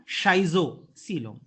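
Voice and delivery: Schizocoelom stretched out slowly syllable by syllable; slow model pronunciation of Schizocoelom; slow drilled pronunciation. Shown as a demonstration. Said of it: "बार बार when we pronounce it ना".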